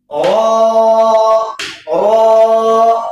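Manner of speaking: رو with rounded lips, thick; rounded heavy ro. Shown as a demonstration. Teacher models a rounded, heavy رو. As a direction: round the mouth for رو. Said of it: "اس طریقے سے".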